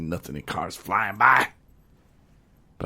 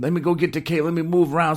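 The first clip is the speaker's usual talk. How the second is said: high-pitched, louder mock woman's voice; fussing lady; 10 semitones higher, 4 dB louder, faster than usual. Lady offering to fetch the cable, high fussing voice. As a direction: play the lady piping up high. Voicing the eager lady in story.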